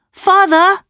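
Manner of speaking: high, loud call for father; child's cry. A narrator's reading. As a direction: cry out after a departing parent. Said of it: "Fenella cried".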